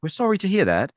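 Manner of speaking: a hint of polite British; polite British reply, lightly mocked; British politeness. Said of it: "so British".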